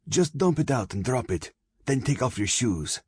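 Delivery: high-pitched, impatient command; young robber giving orders, pitch up; bossy orders. A narrator's reading. Girl ordering her victim about.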